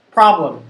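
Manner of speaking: "Problem" with an aspirated p, stressed; strong puff on the p of "Problem"; correct pronunciation. Shown as a demonstration. Teacher repeats "Problem" with an aspirated p. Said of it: "I made a mistake with problem".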